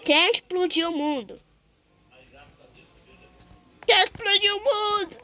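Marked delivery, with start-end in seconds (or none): 3.9-5.2 the repeated question, now crying out; shouted cry. Acted. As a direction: yell the question like a wail.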